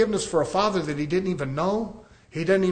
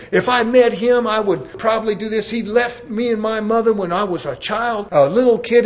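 imitating a man's rage, louder and higher; retold angry outburst, pitched up; raging; 5 semitones higher, 5 dB louder than usual. Police officer to counselor about his absent father, raging. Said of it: "and he started raging".

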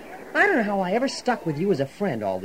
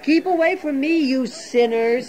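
haughty holy man, much higher; mocking Zadok; 7 semitones higher, 4 dB louder than usual. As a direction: sneer it out like a snooty Pharisee. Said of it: "You've been watching the old Pharisee Zadok".

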